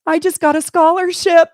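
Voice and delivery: daughter's news burst out high and loud; excited daughter. Retold or imitated. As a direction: blurt the good news, thrilled. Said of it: "She goes".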